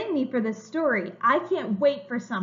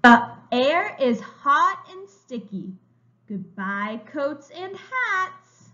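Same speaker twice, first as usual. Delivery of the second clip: slow, bright storybook reading; reading to children; 2 semitones higher, 6 dB louder, much slower than usual. A narrator's reading. Teacher reading a picture book to young children, slow and bright. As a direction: read it like a picture book for little kids.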